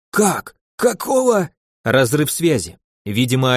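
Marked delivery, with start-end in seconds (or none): 0.1-1.5 sharp rising shocked outburst; shocked disbelief. Acted. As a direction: blurt it out, stunned. Curator shocked at death news.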